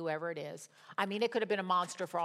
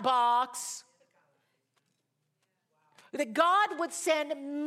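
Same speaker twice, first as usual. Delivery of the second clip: higher, louder, slow and drawn-out; preaching crescendo; 6 semitones higher, 5 dB louder, slower than usual. Acted. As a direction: build it up like a preacher at full force. Preaching climax to congregation.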